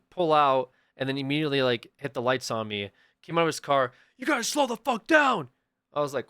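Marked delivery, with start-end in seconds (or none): 4.2-5.5 high-pitched yelled imitation of an officer; screaming cop. Cop storming out of his car, screaming. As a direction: shout it as an aggressive cop. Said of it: "he starts screaming at me".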